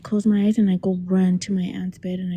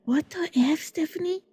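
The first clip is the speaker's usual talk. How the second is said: higher-pitched, incredulous aunt; exasperated aunt; 8 semitones higher, 3 dB quieter than usual. Retold or imitated. Aunt startled by her screaming niece, exasperated. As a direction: mimic an aunt's baffled scolding, pitched up. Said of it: "and my aunt's like".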